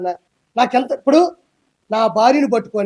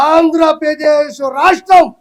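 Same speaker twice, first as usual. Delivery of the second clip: slow, loud, higher proclamation; emphatic shout; 5 semitones higher, 6 dB louder, much slower than usual. Real, not acted. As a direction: shout it out slowly, word by word. Emphatic political rant, declaring state. (నెమ్మదిగా, గట్టిగా అరుస్తూ)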